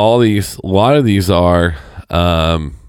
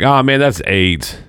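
drawn-out, slightly higher mock teen complaint; stretched-out imitation of a whining teenager; imitating upset teen; 4 semitones higher, slower than usual. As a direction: groan it like an exasperated gamer kid. Imitating his kid's upset outburst.

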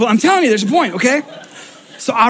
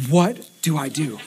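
softer, lower, slow half-laughing whisper; whispered panic; 6 semitones lower, 6 dB quieter than usual. Retold or imitated. Husband whispering to wife in the dark, helpless laughing whisper.

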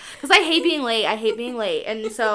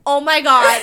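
loud, higher retold outburst of dismay; mock mortified exclamation; 4 semitones higher, 11 dB louder than usual. Storyteller realizing she's at the wrong house, loud mock horror.

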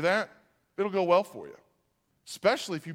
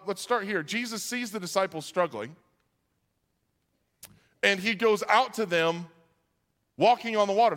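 usual speaking voice

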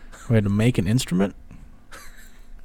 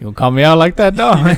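loud retort; 6 semitones higher, 9 dB louder than usual. Retold or imitated. Voicing the kid's retort.

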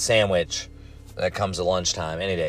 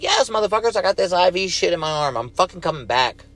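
much higher, fed-up mock yell at the staff; exasperated reply; 9 semitones higher than usual. To staff asking if he's coming back, a high fed-up retort. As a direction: roll your eyes and shout it back at them. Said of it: "I'm like".

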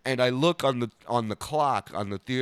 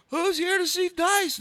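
high-pitched host hyping the crowd; crowd-rousing call; 4 dB louder than usual. Retold or imitated. Retold shout to theater crowd.

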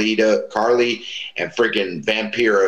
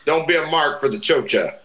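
higher, lightly voiced mentor lecturing; mentor's advice; 7 semitones higher than usual. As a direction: set the kid straight like a big brother. Mentor Ricky advising young wrestler.

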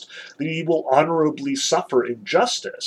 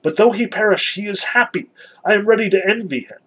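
raised pitch, fervent read-aloud declaration; Kolya exclaiming; 7 semitones higher, 4 dB louder, faster than usual. A narrator's reading. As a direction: carry on the boy's exclamation, higher. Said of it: "exclaimed Kolya".

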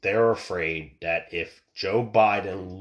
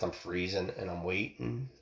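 usual speaking voice

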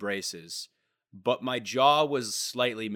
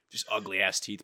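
usual speaking voice

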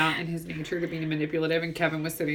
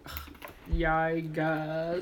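slow, drawn-out grudging admission; mock condescending; 6 dB quieter, much slower than usual. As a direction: imitate a condescending guy conceding with an ugh. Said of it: "he was being so condescending with her".

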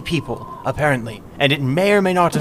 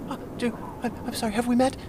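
nervous whisper; 9 semitones higher, 8 dB quieter, much faster than usual. Acted. Captive nervously asks, knife at throat.

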